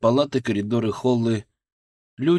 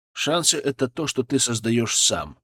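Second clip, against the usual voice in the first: usual speaking voice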